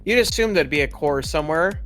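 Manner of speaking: normal talking voice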